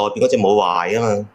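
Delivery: usual speaking voice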